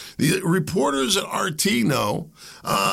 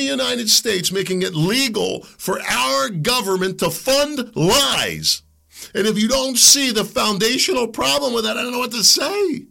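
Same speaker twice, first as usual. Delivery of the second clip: indignant shout; 7 semitones higher than usual. Real, not acted. Host fuming at government-funded lies, shouting. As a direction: shout it in disbelief.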